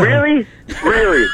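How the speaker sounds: high-pitched, disbelieving "really?"; incredulous wife. Retold or imitated. Wife's disapproving reaction, acted out.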